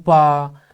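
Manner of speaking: पा held long as a single flow; one sustained syllable. Shown as a demonstration. Teacher showing a lone syllable, one sustained flow. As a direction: stretch out the vowel of पा. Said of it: "तो वो एक है".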